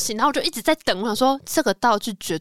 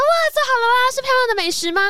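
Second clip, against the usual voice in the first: put-on excited kid; 14 semitones higher, 3 dB louder than usual. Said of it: "他说".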